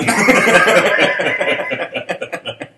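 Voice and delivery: high, loud burst of laughter; real laugh. Real, not acted. Host hears the kids would decline, loud real laughter. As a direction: explode laughing, then trail off.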